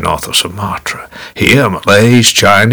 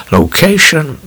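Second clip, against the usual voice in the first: location, higher and louder; emphatic repetition; 10 semitones higher, 4 dB louder, slower than usual. A narrator's reading. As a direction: punch the first location. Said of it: "repeated word building down".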